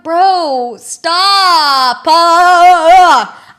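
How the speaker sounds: long, loud drawn-out wail; exasperated yell. Retold or imitated. Passenger losing her mind at screaming kid, exasperated yell. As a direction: wail it at the top of your voice.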